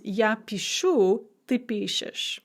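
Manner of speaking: пишу hit on the last syllable, пишешь on the first; shifting stress. Shown as a demonstration. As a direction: stress end of пишу, then start of пишешь. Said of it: "And then shifting stress".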